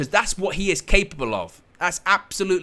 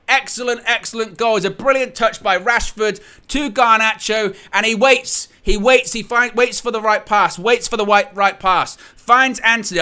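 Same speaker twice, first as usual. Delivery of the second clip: still excited; 6 semitones higher, 5 dB louder than usual. Real, not acted. Replaying the goal buildup.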